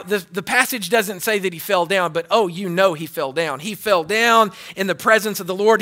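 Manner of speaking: ordinary talk, nothing marked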